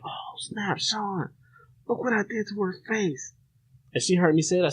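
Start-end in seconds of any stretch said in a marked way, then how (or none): 0.0-3.3 panicked whisper to a friend. Retold or imitated. Whispering to Sean about the girl's face. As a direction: hiss it under your breath, scared.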